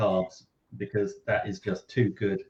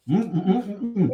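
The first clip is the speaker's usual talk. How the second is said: mouth full; 8 semitones higher than usual. Real, not acted. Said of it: "difficult to eat and, uh, talk with your mouth full".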